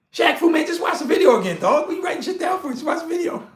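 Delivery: high-pitched, half-laughing holler; playful scolding. Real, not acted. Teasing Shaq Fu for writing this down, playful scolding. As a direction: call him out in mock exasperation, voice shooting up.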